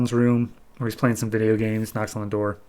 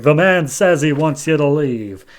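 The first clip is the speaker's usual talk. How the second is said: mock hero voice; 4 semitones higher, 5 dB louder than usual. Hero's voice off panel.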